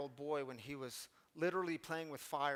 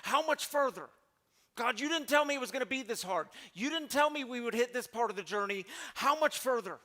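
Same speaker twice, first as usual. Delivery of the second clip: higher and louder desperate plea; exasperated cry to God; 5 semitones higher, 5 dB louder than usual. Pretend. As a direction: throw your arms up and demand an answer. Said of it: "put your arms in the air and say".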